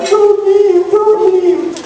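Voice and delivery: put-on high, wailing little girl; crying plea. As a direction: beg through tears like a child. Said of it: "Julie's crying".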